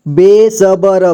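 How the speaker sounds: बेसबर drawn out in separate syllables. Shown as a demonstration. Teacher showing learners how to read बेसबर, slowly syllable by syllable. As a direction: sound out बेसबर slowly, piece by piece. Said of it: "जोड़ जोड़ करके".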